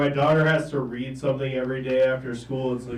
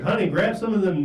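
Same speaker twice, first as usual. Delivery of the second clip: put-on louder Southern old guy; Southern accent; 5 semitones higher, 6 dB louder than usual. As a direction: call to the wife in a Southern accent. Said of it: "this old guy tell his wife".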